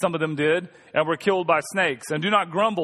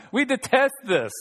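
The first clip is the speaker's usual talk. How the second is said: disgusted complaint; 5 semitones higher than usual. Retold or imitated. Quoting people complaining to God.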